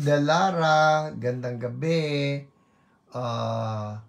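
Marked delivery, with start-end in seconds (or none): none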